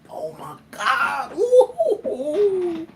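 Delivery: laughing, high squeal of delight; overjoyed laugh. Retold or imitated. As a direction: melt into a giddy laugh. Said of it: "I'm like".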